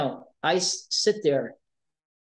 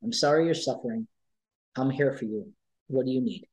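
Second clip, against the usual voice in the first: calming; 3 semitones lower than usual. Acted. Advocate to a sobbing kid in front of them, calming. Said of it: "I'm a calming force for them".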